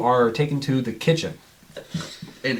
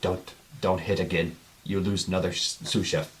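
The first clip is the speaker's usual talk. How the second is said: softer, lightly menacing chef; mild menacing murmur; 4 semitones lower, 8 dB quieter than usual. Acted. Hulking chef warning his new cook, softly menacing. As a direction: mutter a low threat, holding back.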